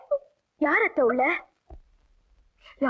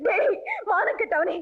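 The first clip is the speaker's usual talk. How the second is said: weep out the shame; crying lament. Acted. Mother lamenting disgrace, crying.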